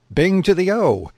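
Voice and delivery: high, loud triumphant cry; nailed it, shout it out; exclaiming. Acted. He-bear triumphantly agreeing with his mate, exclaiming. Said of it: "he exclaims".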